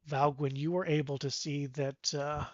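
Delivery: ordinary delivery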